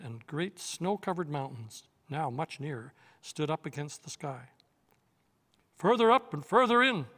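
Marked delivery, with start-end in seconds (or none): 5.8-7.1 high, loud joyful cry; rally the whole party to run on; exultant call to go onward. Acted.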